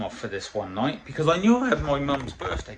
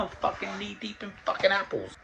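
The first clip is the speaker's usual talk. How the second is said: comic disbelief; 7 semitones higher, 5 dB quieter than usual. Retold or imitated. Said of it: "comic disbelief".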